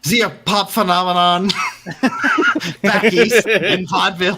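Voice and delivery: high cackle into a teasing question; laughing. Real, not acted. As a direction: laugh through the joke. GM joking.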